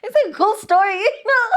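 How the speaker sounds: high giggly laugh through the words. Real, not acted. Laughing as she repeats her own dismissive quip. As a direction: laugh it out, barely holding it.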